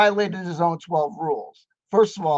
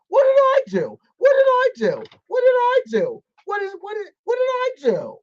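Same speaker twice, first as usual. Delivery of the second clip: high, whiny play-innocent squeak; mock innocent whine; 4 dB louder than usual. Mocking Peterson pretending innocence, high whiny voice. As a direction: whine it like a caught kid playing dumb. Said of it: "act like".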